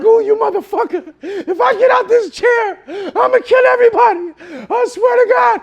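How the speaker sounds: high-pitched, loud raging outburst; enraged threat. Retold or imitated. Patient strapped in chair to dentist, enraged shouting. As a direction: yell threats in helpless fury, acting it out.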